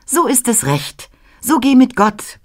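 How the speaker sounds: viel höher und hell, segnend; Christkind. A narrator's reading. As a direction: das Christkind gibt hell seinen Segen. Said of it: "Christkindlein sprach". (hohe, helle Christkindstimme)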